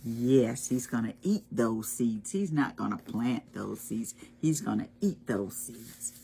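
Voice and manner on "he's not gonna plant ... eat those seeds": usual speaking voice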